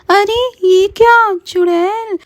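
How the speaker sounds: very high, slow terrified voice; panicked. Acted. Meenal facing the witch, very high terrified cry. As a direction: shriek it in fear. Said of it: "Meenal घबरा कर बोलती है".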